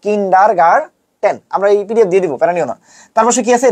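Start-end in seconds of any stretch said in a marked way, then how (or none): 0.0-1.4 "কিন্ডারগার্টেন" drawn out as kin-dar-gar-TEN; slow teaching "কিন্ডারগার্টেন" ending in "ten"; slow hard TEN. Shown as a demonstration. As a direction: break "কিন্ডারগার্টেন" into slow syllables, hard t at the end. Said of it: "টেনটা important".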